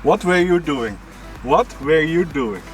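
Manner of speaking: normal talking voice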